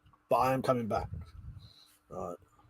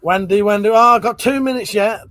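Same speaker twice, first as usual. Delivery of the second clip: calling out; 4 semitones higher, 10 dB louder than usual. Real, not acted. Calling out to Wendy house.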